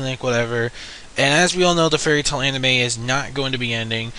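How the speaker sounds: ordinary delivery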